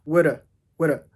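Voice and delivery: Woulda with a schwa ending; woulda, short vowel to schwa; reduced woulda. Shown as a demonstration. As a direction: drop the v: "woulda". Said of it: "nos quedaría woulda".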